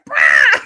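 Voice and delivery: low, loud squawk; bird squawk. Retold or imitated. The bird squawking at her again, low loud squawk. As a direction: bark it like an angry bird. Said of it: "He's like".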